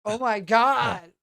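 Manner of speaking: drawn-out loud oh my God; loud slow exclamation. Real, not acted. Overwhelmed by the comic.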